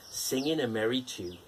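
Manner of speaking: ordinary delivery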